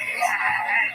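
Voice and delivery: short laugh at own joke; amused laugh. Real, not acted. Comic laughing at his own joke, chuckling.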